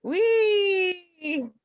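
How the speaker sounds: high, drawn-out gleeful squeal; playful whee. Pretend. Co-host fooling around on the video call, high playful whee. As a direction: play at being on a ride. Playing with face on screen.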